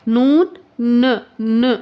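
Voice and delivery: "नून, न" drawn out very slowly; slow clear noon. Shown as a demonstration. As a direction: give "नून, न" as a long, deliberate model. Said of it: "क्या कहना". ("नून, न" बहुत धीरे, खींचकर बोलें)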